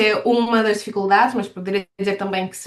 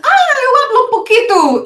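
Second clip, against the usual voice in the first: high, loud mock-helpful outburst; hammed-up retold outburst, climbing in pitch and volume; imitated eager switcher to Spanish; 13 semitones higher, 7 dB louder than usual. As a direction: leap up high, imitating someone keen to try Spanish. Student mimics Portuguese switching to Spanish.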